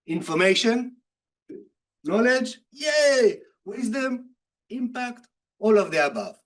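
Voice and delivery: rising excited list. Real, not acted. Excited listing of answers.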